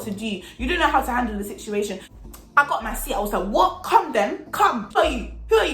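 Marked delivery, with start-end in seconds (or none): 3.5-5.7 raised, punchy retort; confrontational challenge. Retold or imitated. Getting up from her seat to fight, confrontational. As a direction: square up with short challenges.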